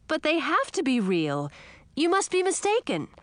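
put-on high, pleading young girl; a small frog insisting it must be true; frog princess.

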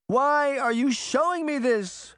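high, loud and slow plea; anguished cry. A narrator's reading. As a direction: cry it out, bewildered. Sabrina confronting the mirrors.

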